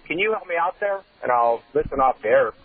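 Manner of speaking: ordinary talk, nothing marked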